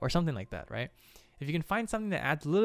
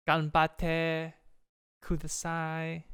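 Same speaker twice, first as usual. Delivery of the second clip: loud, bright Japanese cheer with a slowed final word; cheering Japanese phrase; 4 semitones higher, 5 dB louder, much slower than usual. Retold or imitated. Animator voicing the character's cheer in a self-mocking Japanese accent. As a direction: shout ganbatte then stretch kudasai, mock Japanese accent. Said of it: "my horrible accent".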